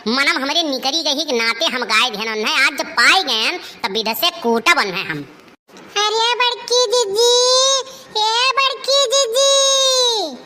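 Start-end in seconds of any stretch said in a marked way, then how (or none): 6.0-10.4 high, carrying call across the yard; sing-song calling. Acted. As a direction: call for the elder sister from outside. Calling out to sister again.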